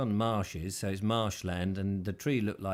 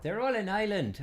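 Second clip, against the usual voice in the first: high, sing-song Irish lilt on "They're all in Ireland"; playful Irish brogue, pitched high; mock Irish accent; 11 semitones higher than usual. Shown as a demonstration. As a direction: put on a jokey Irish accent. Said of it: "you can't do my accent now".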